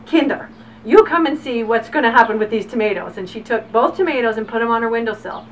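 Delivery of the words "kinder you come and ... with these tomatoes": lightly imitated German grandmother, louder; German accent. Grandmother calling the children to see tomatoes, German accent. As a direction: call out with a touch of German accent. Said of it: "in her German accent".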